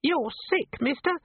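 squeaky imp. A narrator's reading. Imp character voice.

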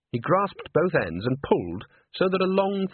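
ordinary delivery

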